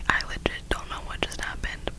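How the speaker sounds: normal talking voice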